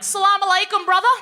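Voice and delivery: eager dad greeting. Retold or imitated.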